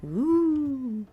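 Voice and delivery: normal talking voice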